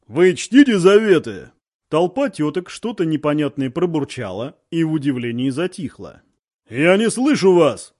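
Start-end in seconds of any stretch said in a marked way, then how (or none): 0.1-1.5 loud, hammered shout; shouted question. A narrator's reading. Officer shouting a question at the crowd of women, loud. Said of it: "снова заорал". 6.7-7.9 slow, loud yell; shouting. A narrator's reading. Political officer demands the crowd answer louder, slow shout. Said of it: "заорал замполит".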